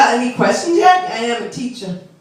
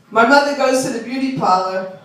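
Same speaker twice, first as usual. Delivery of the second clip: ordinary talk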